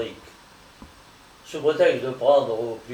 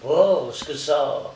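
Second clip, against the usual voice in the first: startled cry; 2 semitones higher, 4 dB louder, slower than usual. Acted. Strong, just through the door, crying out startled. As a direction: cry out in sudden alarm. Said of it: "il s'écria".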